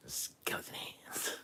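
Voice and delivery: slow eerie whisper, imitating Sylvia; Sylvia's skeleton whisper. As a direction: drag it out in a spooky whisper. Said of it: "I can't do it like she can".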